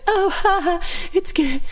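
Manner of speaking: high, giggly, sugary reply; hammed-up giggling sweetness; mock flirty clerk. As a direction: imitate the clerk fawning over a guy. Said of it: "she was super nice to him".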